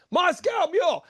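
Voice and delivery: high-pitched mock shout; imitated order call. Customers passing a drink order along, high mock shout.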